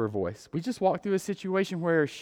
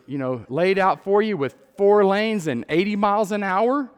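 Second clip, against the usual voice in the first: usual speaking voice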